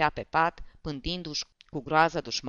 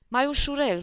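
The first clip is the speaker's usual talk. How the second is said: high-pitched pleading call, louder on the repeat; Poceciuev calling out; 10 semitones higher, 5 dB louder than usual. A narrator's reading. Poceciuev pleads from the next room, high and alarmed. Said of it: "glasul lui Poceciuev".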